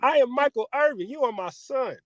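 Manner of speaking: high, loud, sputtering stern father; a father threatening to embarrass his son, high and sputtering; Michael Irvin dad. Retold or imitated. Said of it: "when your daddy talking about".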